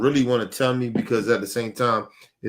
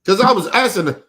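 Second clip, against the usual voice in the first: usual speaking voice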